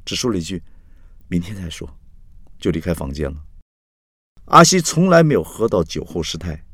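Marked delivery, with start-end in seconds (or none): 1.3-1.9 soft, calm brush-off; composed again. A narrator's reading. Carrie regains calm before leaving Axi, quiet and composed. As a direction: say it quietly, emotion withdrawn. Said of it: "Carrie恢复冷静".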